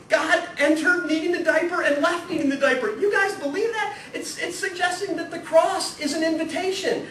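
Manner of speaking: high, loud, laughing classroom harangue; exasperated teacher shout. Retold or imitated. Teacher challenging bored students, loud and high. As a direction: rouse the sleepy sophomores, half laughing. Priest retelling pushing his students.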